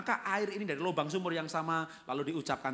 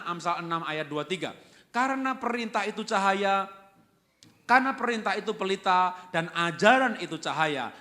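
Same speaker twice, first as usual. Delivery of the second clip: loud, higher preaching voice; 5 semitones higher, 9 dB louder than usual. Acted. Preacher reading Proverbs to the church at full force. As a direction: proclaim the verse to the congregation.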